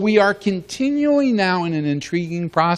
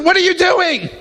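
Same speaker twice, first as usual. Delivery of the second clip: mock alarmed crew chief; 4 dB louder than usual. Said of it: "he said".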